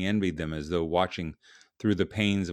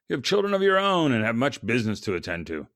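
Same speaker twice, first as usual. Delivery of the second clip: lightly voiced editor, louder and higher; firm words to a brooding friend; icy editor; 4 semitones higher, 5 dB louder than usual. A narrator's reading. Editor to his grieving writer, lightly voiced icy words. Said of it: "jolted from his grief by the icy words".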